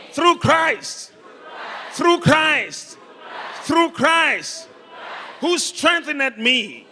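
high, loud call-and-response preacher; full-force preaching. Real, not acted. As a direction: proclaim it for the congregation to shout back. Preaches, leading congregation in chant.

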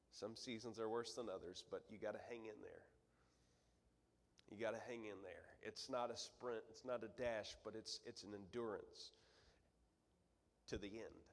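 soft, low encouragement after the peak; calmed down. Real, not acted. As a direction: bring it right down and encourage. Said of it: "I'll calm back down".

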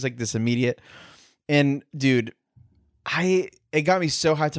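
normal talking voice

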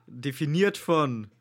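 loud, slow announcer; drawn-out show intro voice. Pretend.

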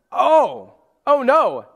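high, loud "oh no" of sudden alarm; alarmed realization. Retold or imitated. Church waking up to the letter, high alarmed oh no. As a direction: gasp it out as the penny drops.

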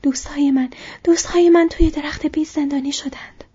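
high, loud and winded; panting. A narrator's reading. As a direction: blurt it out urgently, short of breath. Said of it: "نفس نفس زنان گفت".

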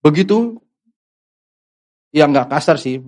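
ordinary talk

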